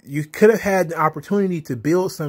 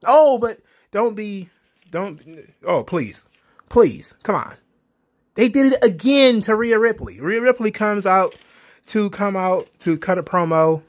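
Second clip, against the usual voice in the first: ordinary talk, nothing marked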